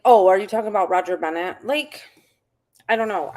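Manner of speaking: ordinary delivery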